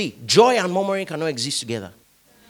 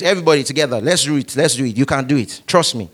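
ordinary talk

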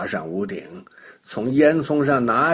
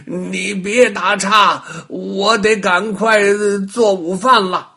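slow, louder grumbling witch; old witch voice; 6 semitones higher, 6 dB louder than usual. A narrator's reading. Old witch on the ladder brushes off the rabbit, slow and gruff. Witch answers while climbing.

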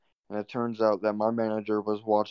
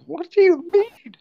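very high, loud, drawn-out mock disbelief; exaggerated high-pitched retort; incredulous squeal; 8 dB louder, slower than usual. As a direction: squawk the question in falsetto. Incredulous retort to manager, retold.

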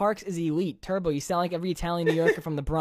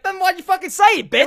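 louder and much higher, half-joking yell; mock yell; 11 semitones higher, 8 dB louder than usual. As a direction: fire it back at the commenter. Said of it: "I'm like".

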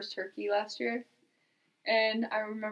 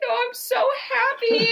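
high, drawn-out mock happy wail; slow, loud, tearful squeal, retold; happy crying; 14 semitones higher, 6 dB louder, slower than usual. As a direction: imitate a friend overwhelmed with joyful tears. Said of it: "just crying".

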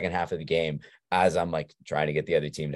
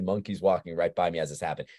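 usual speaking voice

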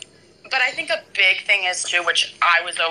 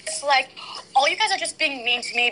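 mock victim; 8 semitones higher than usual. Mimics the girl's complaint.

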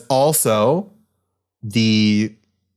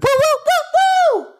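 high hooting woo. Pretend. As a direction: hoot like a celebrating fan. Celebratory hooting.

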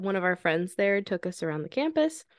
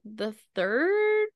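drawn out; 12 semitones higher, 6 dB louder, much slower than usual. Real, not acted. Drawn-out recall.

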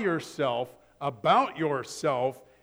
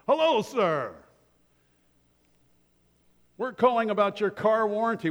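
1 warranty caller; 4 semitones higher than usual. Retold or imitated. Mimicking car warranty robocall.

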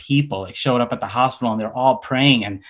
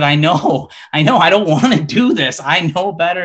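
tearful, pleading, much louder and higher; retold bawling; 6 semitones higher, 6 dB louder than usual. To the officer, a tearful loud plea. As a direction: cry it out, begging. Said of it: "I just started bawling".

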